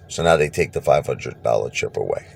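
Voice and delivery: ordinary delivery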